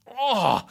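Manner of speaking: loud, high groan of frustration; exasperated groan. Real, not acted. Host fed up over the cancelled race, exasperated groan.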